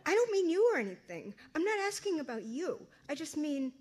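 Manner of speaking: put-on a teenage girl backpedals to her mother, flustered; flustered rush. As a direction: blurt it out embarrassed. Flustered daughter rushing explanation.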